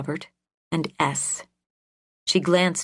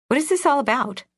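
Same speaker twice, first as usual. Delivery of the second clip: higher, irritated question; annoyed; 9 semitones higher, 3 dB louder, faster than usual. Acted. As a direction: sound put out by the call. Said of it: "The woman sounded annoyed".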